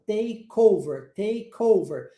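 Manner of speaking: "Take over" joined as "tay-kover"; linked words. Shown as a demonstration. Teacher demonstrates the junction in "Take over", linked words. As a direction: slide the K of "Take" onto "over", unhurried. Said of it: "olha como há uma junção".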